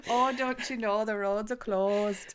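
slow mock Canadian voice; light Canadian accent; Canadian impression. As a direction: draw it out, Canadian. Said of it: "talking about being in Canada".

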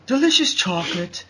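lightly voiced Willy Wonka, going high on chocolate; dreamy candy-maker brushing them off. Wonka brushing off the complaint, lightly voiced. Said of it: "And then he'd be like".

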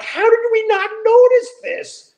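high, incredulous quoted question; a retold gasp of disbelief; amazed disbelief. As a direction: replay the shock of finally noticing.

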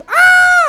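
sudden high scream; a shriek of fright; startled scream. Retold or imitated. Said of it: "You just hear".

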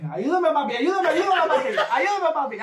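high, whiny mock begging in Spanish; mock pleading. Mimicking drunk friend begging for help, high whiny plea.

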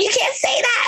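high laughing squeal; high giggly mock scold; laughing mock Kyle. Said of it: "Kyle's like".

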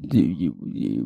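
ordinary talk

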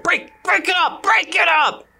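high, forceful shouted order; guard breaking up fight. Acted. Game master as corpsmen breaking up a fight, shouted command. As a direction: shout it like a guard wading into a brawl. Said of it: "the corpsemen come along and".

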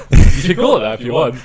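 usual speaking voice

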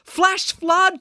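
high, urgent warning shout; panicked alarm; alarmed cry. A narrator's reading. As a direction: cry out the danger. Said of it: "cried Loretta".

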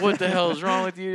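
hammed-up angry bark at his wife; barking yell. Husband retelling yelling at his wife, hammed-up bark. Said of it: "like, barking at her".

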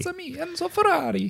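very high cartoon Italian accent; squeaky Mario-style Italian. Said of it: "good Italian accent".

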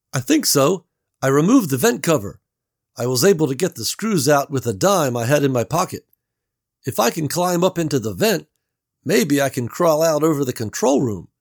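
Gary calling through the wall; Gary's voice. A narrator's reading. Gary explains his plan.

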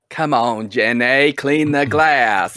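loud, long-drawn character impression; put-on movie voice. Friend parodying a plea to Jenny, loud drawn-out impression. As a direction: stretch the words out in a big parody voice.

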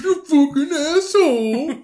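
put-on whiny squeal of a hurt little kid; kid cursing. Retelling the crying kid yelling at his girlfriend, high and whiny. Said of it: "He's like".

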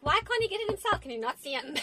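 high-pitched retold indignation; exasperated. Woman retelling her reaction to the paddle demand, exasperated.

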